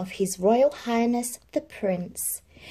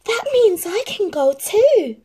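much higher and louder hopeful outburst; eager exclaiming; 13 semitones higher, 8 dB louder than usual. A narrator's reading. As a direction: burst out with sudden hope. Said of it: "exclaimed Cinderella".

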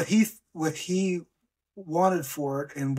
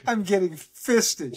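mock grandma quote; 5 semitones higher, slower than usual. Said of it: "she said".

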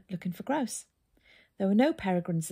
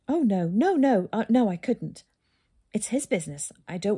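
flustered; 3 semitones higher, 6 dB louder than usual. A narrator's reading. Husband flustered by wife's question.

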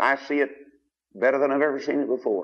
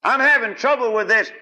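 higher, louder whining complaint; raised complaining voice; imitated complainer; 10 semitones higher, 5 dB louder than usual. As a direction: whine like a troubled church member. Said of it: "You'd say".

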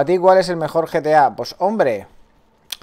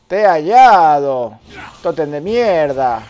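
slow, loud, drawn-out crowing; triumphant shout; 6 dB louder, slower than usual. Real, not acted. Player finding the hidden totem, triumphant drawn-out shout. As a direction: stretch each phrase out in loud triumph.

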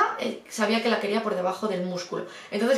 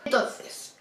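ordinary talk

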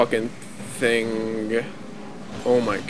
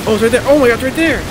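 panicking gamer; 6 semitones higher, 4 dB louder, much faster than usual. Real, not acted. Spots the enemy, panicking.

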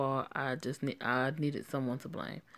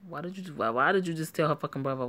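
ordinary talk

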